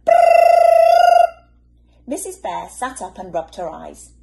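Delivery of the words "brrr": very high, loud rolled brrr; shrill ringing trill; alarm ring. Acted. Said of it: "went the alarm clock".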